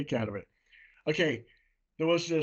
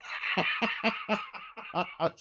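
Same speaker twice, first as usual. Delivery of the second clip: breathy, unvoiced laugh at his own punchline; real laugh; 4 semitones higher than usual. Real, not acted. Telling a joke to Zandy, laughs at his own punchline. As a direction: let the laugh spill out after the joke.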